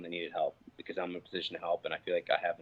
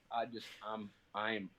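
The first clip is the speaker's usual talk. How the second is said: normal talking voice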